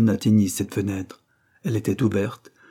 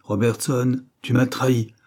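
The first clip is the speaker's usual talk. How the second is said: usual speaking voice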